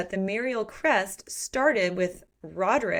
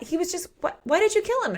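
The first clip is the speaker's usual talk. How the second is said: higher, louder imitation of a freaked-out family; mock panic; 10 semitones higher, 4 dB louder, faster than usual. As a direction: play the family shocked at the table. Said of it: "Everybody freaks out at the table".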